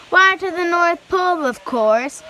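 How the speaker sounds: as if it were obvious; cheery conductor. A narrator's reading. Conductor voiced in story.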